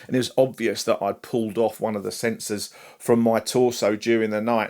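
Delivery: normal talking voice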